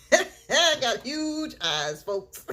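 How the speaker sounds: loud, high, drawn-out laughing boast; playful big laughing holler; laughing exclamation. Real, not acted. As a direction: crack up while stretching out "huge". Laughing boast about her eyes.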